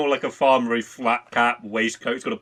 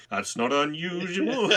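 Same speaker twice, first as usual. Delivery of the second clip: slow, higher crooner line; 6 semitones higher, 4 dB quieter, slower than usual. Retold or imitated. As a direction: croon it slow like a lounge singer. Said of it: "[name] just looks over like".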